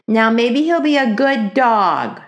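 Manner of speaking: put-on pouting little girl, slow and loud; sulky. A narrator's reading. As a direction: drag it out sulkily. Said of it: "said Ramona sulkily".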